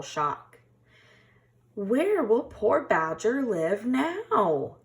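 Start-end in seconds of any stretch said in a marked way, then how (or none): 1.7-4.8 worried gasp. A narrator's reading. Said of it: "gasped Hedgehog".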